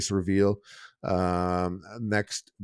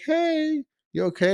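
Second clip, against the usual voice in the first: much higher, lightly concerned. Retold or imitated. Passers-by ask the tripping girl in a high, mock-concerned voice. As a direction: chirp the question up high. People asking her.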